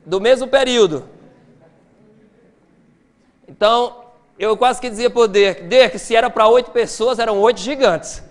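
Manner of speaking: forceful preaching. Acted. Preacher hammering the size of Chinese ships to the congregation, forceful. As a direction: drive the point home from the pulpit.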